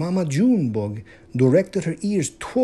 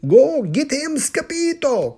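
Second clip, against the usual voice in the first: high, loud cheer; excited rallying yell; cheering cry; 11 semitones higher, 5 dB louder than usual. Acted. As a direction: shout him on like a team of pups. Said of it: "cried the Panchitos".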